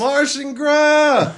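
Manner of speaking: high, slow cheering shout; excited shout. Real, not acted. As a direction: cheer it out like a chant. Cheering the pun.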